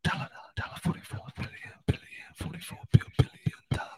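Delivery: eerie breathless whisper; creepy whisper. Pretend. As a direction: whisper it to spook the listener. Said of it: "Just to freak you out".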